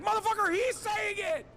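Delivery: furious outburst at critics; exasperated shout. Retold or imitated. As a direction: yell it out in disbelief.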